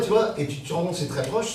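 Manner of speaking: ordinary delivery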